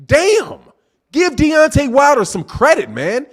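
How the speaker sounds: high, loud frustrated cry of disbelief; exasperated outburst. Real, not acted. Streamer fed up with a chatter, loud exasperated outburst. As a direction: vent at the chat, pitch jumping up.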